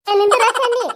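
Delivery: loud, high shout to halt someone; calling out. Acted. Woman calling Chenni to stop, loud high shout. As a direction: holler after them, pitched high.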